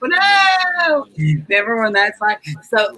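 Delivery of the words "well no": high, loud shocked cry; dizzy dismay. Retold or imitated. Speaker yelps after tumbling down the hill, shocked. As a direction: yelp it out at the bottom of the hill.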